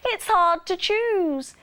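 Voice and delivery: high, loud, lingering exclamation; excited cry. A narrator's reading. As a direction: draw it out high like delighted customers. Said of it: "they cried".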